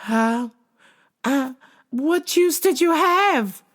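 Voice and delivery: put-on a new girl frozen in panic; flustered panic. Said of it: "she freezes up".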